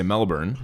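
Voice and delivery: Melbourne as MEL-burn, r sounded; American pronunciation. Shown as a demonstration. Co-host gives the home-country version, American pronunciation. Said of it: "we would say".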